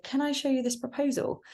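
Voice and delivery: rising, unsure asking; tentative question. Shown as a demonstration. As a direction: ask it hesitantly, rising high. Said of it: "rather than saying something like".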